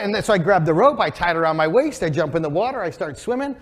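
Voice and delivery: ordinary talk, nothing marked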